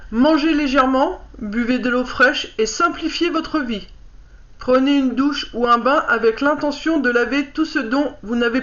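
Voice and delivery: lire le livret posément, un peu plus haut que la parole; lecture à voix haute. Acted. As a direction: read out a card's message clearly, phrase by phrase. Reads card booklet aloud. (lecture appliquée à voix haute)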